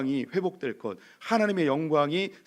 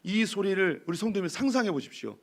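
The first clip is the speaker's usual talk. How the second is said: usual speaking voice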